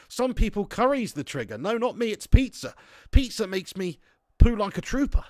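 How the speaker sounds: ordinary talk, nothing marked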